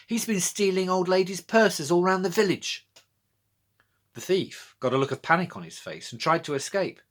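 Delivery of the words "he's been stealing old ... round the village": urgent announcement. A narrator's reading. Girl announcing thief into microphone.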